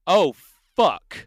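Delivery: loud, high startled cry; acted cry out. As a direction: blurt it out in horrified shock. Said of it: "cry out".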